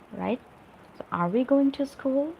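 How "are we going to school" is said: "Are we going to school" high, rising at the end; questioning tune. Shown as a demonstration. Teacher showing learners an interrogative, "Are we going to school" rising high. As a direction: lift "school" into a clear question. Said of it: "we should ask it like a question".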